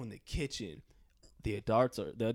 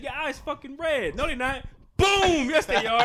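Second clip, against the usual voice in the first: angry mom; 11 semitones higher, 11 dB louder than usual. Retold or imitated. Mom arguing and hitting son.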